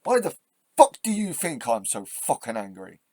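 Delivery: real angry rant; angry outburst. Real, not acted. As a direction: spit out the question in anger. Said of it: "Why are you so angry?".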